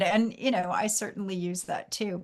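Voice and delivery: normal talking voice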